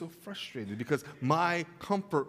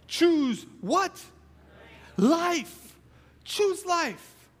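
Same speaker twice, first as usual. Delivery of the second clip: higher and louder, calling out; preaching climax; 10 semitones higher, 5 dB louder, much slower than usual. Acted. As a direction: lift the crowd to the answer. Preaching peak, loud and high.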